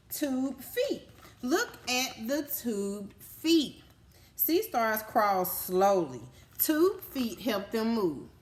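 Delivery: put-on reading to children. Teacher reading sea star facts to kids, slow and emphatic. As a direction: stretch each word out for the class. Reading book page aloud.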